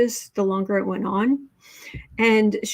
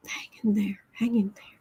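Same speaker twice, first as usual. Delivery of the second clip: whispered, gentle; soft encouragement; 6 dB quieter than usual. Real, not acted. Whispering comfort to frustrated viewer Ricky. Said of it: "encouraging a frustrated viewer".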